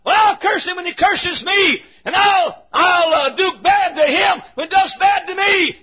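very high, vengeful shout; acted high-pitched vengeful outcry; shouting back. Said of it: "Shallow shouts back".

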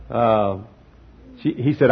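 ordinary delivery

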